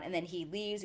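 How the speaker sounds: ordinary delivery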